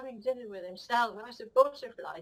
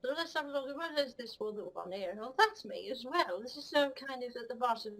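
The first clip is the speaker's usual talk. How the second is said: ordinary talk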